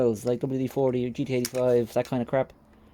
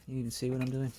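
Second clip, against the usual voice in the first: ordinary talk, nothing marked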